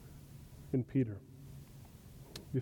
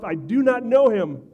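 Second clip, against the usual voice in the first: higher and louder, each word pressed; emphatic denial; 6 semitones higher, 7 dB louder than usual. Acted. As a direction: insist like a cornered man. Peter's repeated denial.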